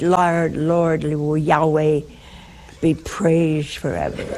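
slow, mock-pious praise through a laugh; hammed-up relief that he can't talk; relieved wife praising. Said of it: "I bet you said".

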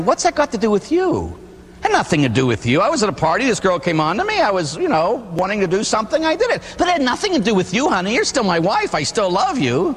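high, pleading, fast excuses; hammed-up guilty husband; excuse-making husband. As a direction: wheedle your way out of trouble. Cheating husband excusing himself.

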